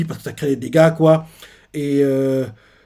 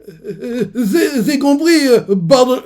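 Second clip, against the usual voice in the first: high, slow, tearful mumbling; beaten, struggling to speak; 10 semitones higher, 6 dB louder, slower than usual. Retold or imitated. Beaten giant begs Harald for mercy, whimpering. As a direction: force the words out through the pain. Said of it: "la personne a du mal à parler".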